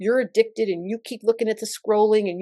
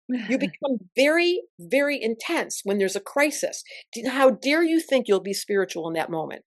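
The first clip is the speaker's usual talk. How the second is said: ordinary talk, nothing marked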